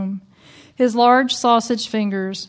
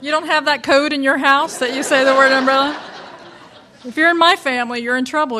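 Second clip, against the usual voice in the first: higher and louder, laughing through it; laughing, raised; 4 semitones higher, 5 dB louder than usual. Real, not acted. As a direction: tease the audience while laughing. Laughing at the family code.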